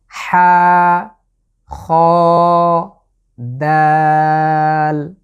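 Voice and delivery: হা খ short, দাল drawn out; letter recitation. Shown as a demonstration. Teacher reviews three letters for viewers. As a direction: recite হা খ quick then stretch দাল. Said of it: "হা এবং খ এটাকে এক আলিফ করে".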